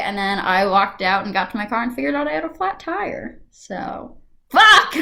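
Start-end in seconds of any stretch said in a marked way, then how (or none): 4.5-4.9 sudden loud, high curse; exasperated shout. Acted. Reacting to finding a flat tire, exasperated shout. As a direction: burst out with it.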